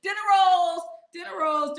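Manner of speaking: high, loud punchline; high loud hoot; excited. Real, not acted. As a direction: shout the joke. Repeats the joke louder.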